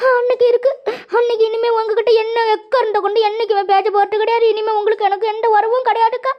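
high, sobbing protest of a wronged friend; tearful wailing. Acted. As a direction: wail it out, gasping between phrases. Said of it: "இப்படி அழுதுகிட்டு சொல்றாளா". (அழுது ஒப்பாரி வைக்கும் குரல்)

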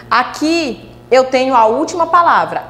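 ordinary talk

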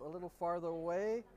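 normal talking voice

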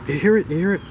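high, insistent questions; urgent bride. Acted. Bride shaking her husband again, high and urgent.

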